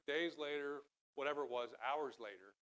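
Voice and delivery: ordinary delivery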